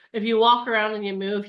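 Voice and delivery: ordinary talk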